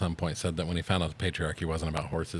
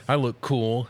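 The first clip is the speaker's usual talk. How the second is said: a bit higher, pleased with himself; proud Ken; 5 semitones higher, 3 dB louder, slower than usual. Retold or imitated. Ken showing off to the Barbies, mildly proud. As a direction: announce it like a proud boy. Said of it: "And he goes".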